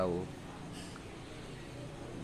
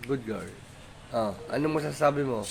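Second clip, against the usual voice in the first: normal talking voice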